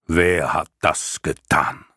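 slow, booming lion voice; a huge beast rumbling slowly. Acted. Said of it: "grollende Stimme".

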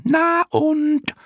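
extremely high, loud squeaky jeer; clown moth calling down. A narrator's reading. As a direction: squeal it down cheekily. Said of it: "die erste rief nach unten". (frech hinuntergepiepst, sehr hoch und laut)

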